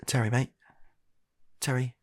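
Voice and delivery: ordinary talk, nothing marked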